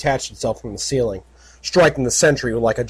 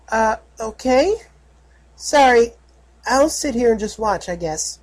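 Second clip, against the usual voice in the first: little filly voice; 10 semitones higher than usual. Acted. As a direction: back down like a scolded little girl. Filly scolded, sulks.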